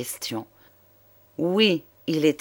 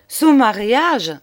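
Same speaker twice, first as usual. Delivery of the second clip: high, louder, drawn-out astonished cry; surprised cry; 7 semitones higher, 7 dB louder, slower than usual. A narrator's reading. Juliette cries out at the idea of Noël's marriage, astonished. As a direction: exclaim it in amused disbelief. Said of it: "s'écria Juliette en éclatant de rire".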